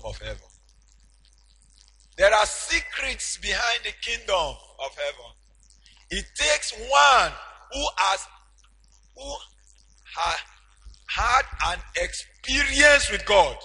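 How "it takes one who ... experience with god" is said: preaching at full force. Acted. Preacher telling congregation of Peter, in full preaching cry. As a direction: lift the church with it.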